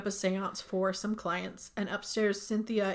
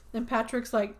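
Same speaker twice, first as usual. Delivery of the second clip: usual speaking voice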